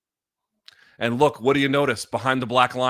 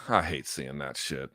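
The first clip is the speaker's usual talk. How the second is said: much lower and softer, heavy and downcast; choked up; 10 semitones lower, 9 dB quieter than usual. Real, not acted. Streamer watching a dejected fighter, quietly choked up. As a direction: hold back tears for the beaten fighter. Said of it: "I'm about to get a little choked up".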